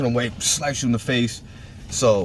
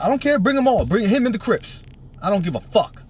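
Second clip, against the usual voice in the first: mock-furious yell, much higher; defiant yell; 9 semitones higher than usual. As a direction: bark it back, daring them to come. Yelling defiance at rival gang.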